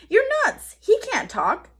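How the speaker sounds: high, loud scolding; exasperated. A narrator's reading. Boy scolding his sister for talking to a dinosaur. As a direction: scold her, incredulous.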